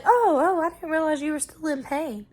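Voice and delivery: high, loud "oh" easing to normal volume; doctor acting surprised. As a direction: imitate a doctor's startled apology. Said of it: "And he was like".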